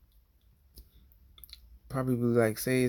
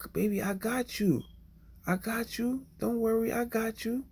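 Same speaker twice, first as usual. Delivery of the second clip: high, gentle coaxing voice, pitched way up; calm a terrified kid you just grabbed; soothing a child; 11 semitones higher than usual. Retold or imitated. Rescuer to a screaming child in a storm, high and soothing.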